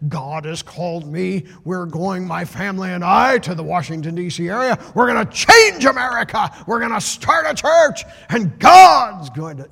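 put-on a young preacher roaring his big announcement from the pulpit; building to an all-out pulpit shout; fiery preaching shout.

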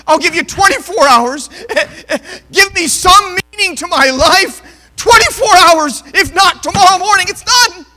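high, loud, tearful ultimatum to God; crying prayer. Retold or imitated. Desperate woman crying out her prayer to God. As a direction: plead through tears, near breaking. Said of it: "she started to cry".

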